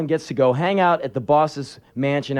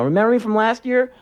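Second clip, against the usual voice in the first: put-on high, taunting child voice; imitated boy back for revenge; vengeful kid; 9 semitones higher than usual. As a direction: taunt him before the payback. Vengeful kid with lead pipe.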